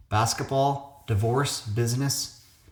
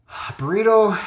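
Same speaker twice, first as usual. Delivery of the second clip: high, loud burst on the word; excited discovery; 10 semitones higher, 6 dB louder, slower than usual. Real, not acted. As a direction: pounce on it like a sudden find. Spotting the key word.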